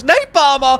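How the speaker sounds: yelling the name, high and loud; shouting. Real, not acted. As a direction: call it out at the top of your voice. Caster yells player name.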